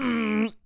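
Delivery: loud mock throat clear; exaggerated throat clear. Host summons a made-up ghost with a loud throat clear. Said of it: "you made that dumb noise".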